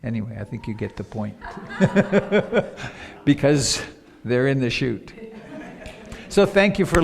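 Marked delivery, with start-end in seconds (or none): 1.4-3.2 short run of breathy laughter; laughing. Real, not acted. Speaker abandoning her example before the audience, laughing. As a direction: laugh it off briefly.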